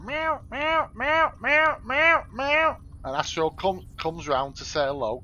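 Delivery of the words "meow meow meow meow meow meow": very high, squeaky mock meowing; cat meows. Greeting his cat Astro on waking, high squeaky meows. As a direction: call the cat with a shrill kitten meow. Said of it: "I go".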